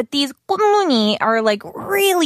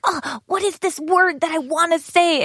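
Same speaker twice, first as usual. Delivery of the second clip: higher-pitched exasperated self-quote; frustrated; 6 semitones higher than usual. Retold or imitated. Re-enacting being stuck for a word with a friend, higher, frustrated. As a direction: act out mild word-hunting frustration. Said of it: "I will get frustrated".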